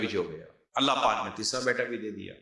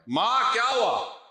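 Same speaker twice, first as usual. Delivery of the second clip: higher, louder, slow worried cry of ma; a son bursting in, alarmed; alarmed call; 11 semitones higher, 5 dB louder, much slower than usual. Retold or imitated. Eldest son finding mother crying, alarmed high call.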